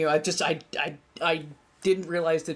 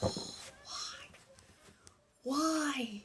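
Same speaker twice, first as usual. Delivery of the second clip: barely voiced, baffled plea; dismayed whisper; 7 semitones higher, 12 dB quieter than usual. Real, not acted. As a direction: whisper it in utter disbelief.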